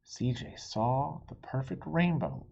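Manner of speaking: usual speaking voice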